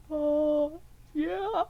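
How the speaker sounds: high awkward laugh; nervous giggle through the words; laughing awkwardly. Retold or imitated. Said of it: "And I'm like".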